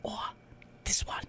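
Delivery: soft whisper. Retold or imitated. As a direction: join in with a hush. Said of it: "Pick a spot".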